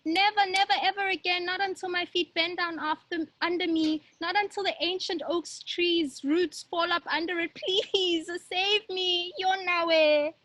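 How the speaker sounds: put-on girl in the bag pleading with the monster; crying plea. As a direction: beg for mercy, tearful. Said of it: "She cries".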